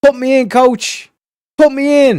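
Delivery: high, pleading put me in coach; pleading player. Retold or imitated. Sidelined fan begging the coach to play him, high and pleading. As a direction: beg the coach from the bench, rising. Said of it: "sitting on the sideline going".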